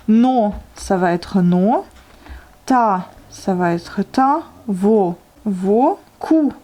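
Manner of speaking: slowly sounding out each syllable; slow syllable reading. Shown as a demonstration. Teacher dictating syllables to students, slow and spaced. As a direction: read no, ta, vo, ku one by one, drawn out. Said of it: "transcrivez les syllabes suivantes".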